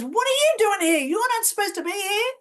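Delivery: loud, high scolding; mock-angry outburst at an intruder; angry woman. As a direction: demand it indignantly, pitched well up. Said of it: "she's so angry".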